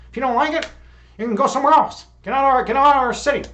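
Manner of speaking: hammed-up Chicago local shouting outsiders away; tough Chicago talk. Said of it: "That's how we talk Chicago style".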